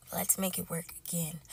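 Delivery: hushed, half-whispered mock plea; fake-sincere plea. Woman calling back her blocked ex, hushed fake plea. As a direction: lure him back softly before the prank.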